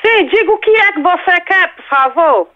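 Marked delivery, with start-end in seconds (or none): none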